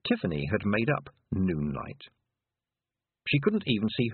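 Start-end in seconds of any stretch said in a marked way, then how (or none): none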